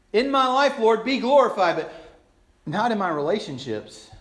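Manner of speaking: high, loud praise dropping to a hedge; mock devotion. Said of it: "we sing it half-heartedly".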